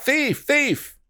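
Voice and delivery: high alarmed cry of thief. Acted. Shop owner alarmed at the thief, a high cry. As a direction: shout the alarm into the night.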